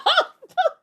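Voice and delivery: shrieking laugh; can't hold the laugh in; high burst of laughter. Real, not acted. Vlogger cracks up at the kiss scene, high laughter.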